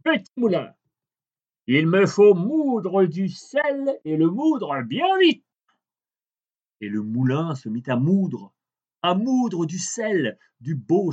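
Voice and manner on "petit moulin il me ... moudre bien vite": high, slow captain voice; greedy captain. A narrator's reading. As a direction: drag it out, high and commanding. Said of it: "il prit le petit moulin et lui dit".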